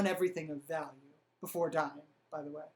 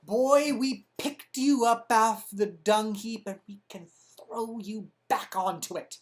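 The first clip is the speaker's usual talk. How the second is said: louder, growling threat from a lightly voiced old Italian man; a hint of an angry old Italian doge; Dandolo as an old angry Italian; 3 dB louder than usual. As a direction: lean in close and menace the boy. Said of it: "pardon my old angry Italian man impression".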